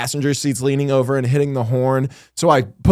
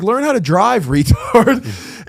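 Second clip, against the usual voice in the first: retold yell; 8 semitones higher, slower than usual. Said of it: "I was just yelling".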